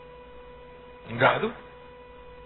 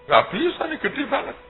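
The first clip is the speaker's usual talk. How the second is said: an amazed mayor's man, voice pitched high; mock astonishment; 13 semitones higher than usual. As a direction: play the stunned official, pitched up. Imitating astonished official.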